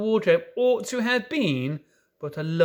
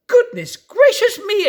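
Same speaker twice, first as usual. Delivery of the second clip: much higher, louder squeak of surprise; startled faun exclaim; 9 dB louder than usual. A narrator's reading. Faun startled by Lucy, squeaking in surprise. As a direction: yelp it like a startled little faun. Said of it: "exclaimed the faun".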